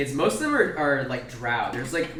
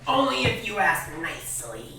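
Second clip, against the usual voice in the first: put-on high, bossy little-kid voice; kobold kid; 6 semitones higher, 4 dB louder than usual. Kobold atop the bear to goblin kids, bossy child voice. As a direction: lord it over them playfully. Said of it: "looks down and says".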